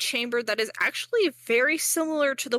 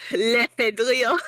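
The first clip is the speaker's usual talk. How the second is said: mock French; 3 semitones higher, 7 dB louder than usual. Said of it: "learn how to do a French accent".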